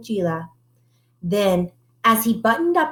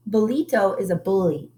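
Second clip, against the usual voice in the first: plain reading voice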